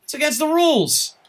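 high, loud shocked exclamations; outraged disbelief. Real, not acted. Streamer protesting a brutal hit in the game, loud outrage. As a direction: cry foul in escalating disbelief.

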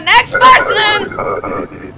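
long, drawn-out high shout; calling out. Pretend. Inspector summoning the next traveller, calling out. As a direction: holler it down the line, stretching each word.

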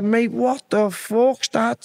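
high and slow, woozy disbelief; dazed shock. Retold or imitated. Lad reeling from nicotine, asking the guard, slow and stunned. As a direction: stretch it out like your head is spinning.

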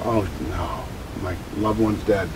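a quiet groan of grief on waking; dismayed waking. Retold or imitated. Griever waking each morning, soft dismay. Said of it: "your eyes go".

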